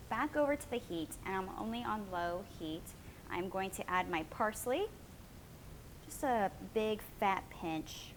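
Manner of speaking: ordinary talk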